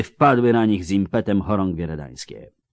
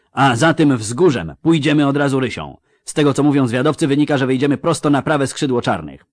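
higher, lightly raised commanding voice; military order; 5 semitones higher, 4 dB louder, faster than usual. A narrator's reading. General briefing his commanders on the attack, commanding. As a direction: lay out the attack for your officers. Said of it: "rozkazał dowódcom".

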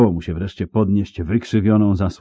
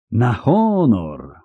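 very slow, stretched oath; long, lingering exclamation; drawn-out; 5 semitones higher, much slower than usual. A narrator's reading. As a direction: draw out the words with knightly weight. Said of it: "powiedział przeciągle".

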